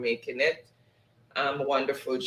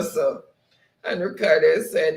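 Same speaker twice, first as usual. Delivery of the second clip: ordinary delivery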